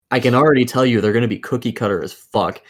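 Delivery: ordinary delivery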